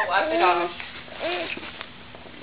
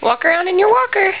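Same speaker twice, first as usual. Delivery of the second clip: very high, louder sing-song; 10 semitones higher, 4 dB louder than usual. Real, not acted. High baby talk to baby.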